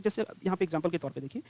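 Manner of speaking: ordinary delivery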